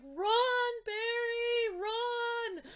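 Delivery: high, drawn-out yell; loud fan cry with stretched words; retold screaming. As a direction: relive urging the hero on. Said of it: "I'm screaming at the T V".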